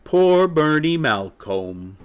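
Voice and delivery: high, slow, drawn-out pity; pitying mother hen. Acted. Mother hen pities the supposed victim, drawn-out. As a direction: draw out the name in sorry sympathy.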